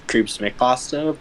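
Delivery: ordinary talk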